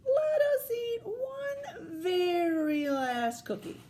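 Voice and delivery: drawn-out whiny plea; pleading Toad. Acted. Toad begs for one more cookie.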